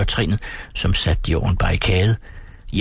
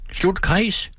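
high calling out; 11 semitones higher than usual. A narrator's reading. Mother calling to Tine to close the ring, high. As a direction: call it across the room, high. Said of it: "råbte hun til Tine".

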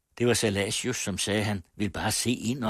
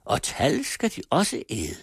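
contemptuous; 9 semitones higher, 4 dB louder than usual. A narrator's reading. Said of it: "sluttede Silla foragteligt".

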